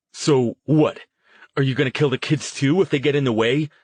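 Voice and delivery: high, loud, fast angry demand. Acted. Jason accusing Harrison while held back, loud angry demand. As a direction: throw the accusation at him. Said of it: "Jason demanded".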